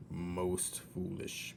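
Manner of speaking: soft, slow mutter. Acted. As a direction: grumble it under his breath. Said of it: "muttered Gregory".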